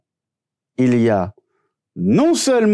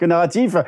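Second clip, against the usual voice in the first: evil chuckle; slower than usual. Acted. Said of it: "T'as fait ton petit rire, euh, diabolique".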